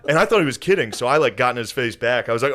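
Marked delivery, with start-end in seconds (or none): none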